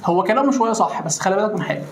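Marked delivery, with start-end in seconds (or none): none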